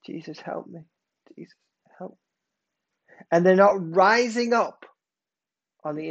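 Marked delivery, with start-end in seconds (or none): 0.1-2.2 very soft, small cry for help; feeble mock plea. Christians under pressure praying to Jesus, feeble mock plea. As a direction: imitate a barely audible, half-hearted prayer. Imitating timid, muted prayers.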